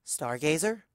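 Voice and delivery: small, hesitant question; scared little filly. A narrator's reading. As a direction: ask timidly like a frightened filly. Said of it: "like a scared little filly".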